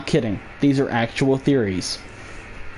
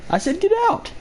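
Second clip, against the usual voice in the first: squeaky high yell. Pretend. High second voice yelling.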